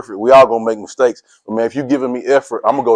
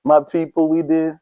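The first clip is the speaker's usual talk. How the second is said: mock whiny complaint; 4 semitones higher, slower than usual. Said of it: "I'm not saying".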